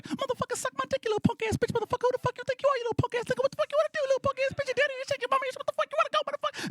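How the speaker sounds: put-on squeaky, very high, rapid-fire kid voices; swearing little kids. Little kids in the street swearing at each other, squeaky mock tirade. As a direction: imitate little brats cursing each other out. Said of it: "just swearing their asses off at each other".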